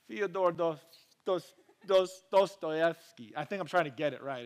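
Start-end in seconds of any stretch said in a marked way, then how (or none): 0.1-3.3 halting, slow bad Russian accent. Shown as a demonstration. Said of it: "It's like my really bad Russian accent".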